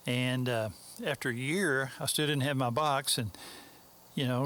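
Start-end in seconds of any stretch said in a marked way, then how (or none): none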